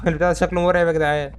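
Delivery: ordinary talk, nothing marked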